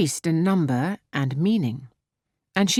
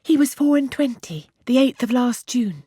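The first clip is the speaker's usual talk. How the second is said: put-on higher, eager girlish voice; excited young girl, a bit higher; Harriet; 7 semitones higher than usual. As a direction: rush it out with naive delight. Harriet gives his age eagerly.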